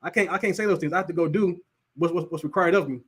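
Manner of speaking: ordinary delivery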